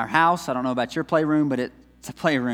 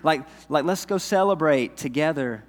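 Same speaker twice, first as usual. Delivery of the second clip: ordinary talk, nothing marked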